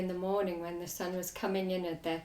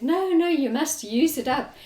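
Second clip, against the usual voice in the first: insistent retort; 7 semitones higher, 6 dB louder than usual. Retold or imitated. Retold insistent reply to customer.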